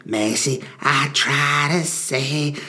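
slow husky mock voice; hammed-up husky voice with a cold. Shown as a demonstration. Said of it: "I've been doing husky people".

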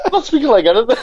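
high, laughing bit of Cockney; imitating himself slipping into Cockney; Cockney accent. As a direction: protest in a put-on Cockney, amused. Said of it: "You just started speaking like this Cockney accent".

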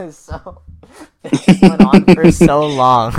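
loud, high, laughing through the words; helpless laughter while talking, drawn out; cracking up. Real, not acted. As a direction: barely get it out for laughing. Cracking up after the bit.

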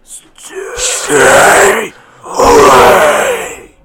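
drawn-out ghostly whispered shriek; phantom whisper scream. Acted. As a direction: rasp a slow, loud phantom warning. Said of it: "and it whispered".

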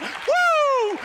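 high, ringing "woo"; excited whoop. Real, not acted. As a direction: cut loose with a joyful whoop. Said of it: "I just got excited".

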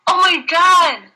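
high, excited disbelief; amazed. Real, not acted. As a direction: gush in amazement. Sees the signed book.

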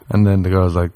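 ordinary delivery

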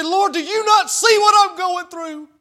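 high, loud shout up to the Lord; pleading shout of prayer; desperate cry. Acted. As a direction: cry out to heaven in anguish.

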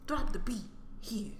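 slow breathy whisper. Real, not acted. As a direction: whisper the cue slowly. Whispered cue to the beat.

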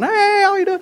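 very high, chirpy hello; overly friendly falsetto greeting; mock-cheerful squeak; cheery greeter. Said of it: "I'm going".

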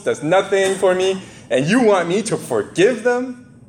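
high, slow, aggrieved whine; indignant complaint. Acted. Peter complaining to Jesus, high and aggrieved. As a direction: plead the grievance with wide-eyed outrage.

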